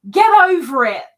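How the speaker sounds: playful stern get over it; imitated firm telling-off. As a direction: bark it like a no-nonsense friend. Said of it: "Miss Lisa is going to say".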